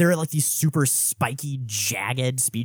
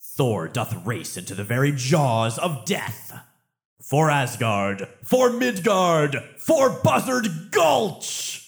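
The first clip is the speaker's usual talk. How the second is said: put-on rally the troops like an old Norse warrior, ending half-sung; viking; slower than usual. Voicing Thor's battle cry.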